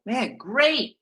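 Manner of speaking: bright, high "great" over a letdown; mock cheerful. Agent hearing another agent's name, fake cheer. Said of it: "you go".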